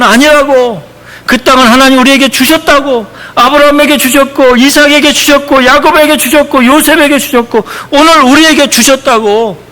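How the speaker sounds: preacher's rising impassioned shout; shouting protest. Retold or imitated. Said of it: "막 소리치면서".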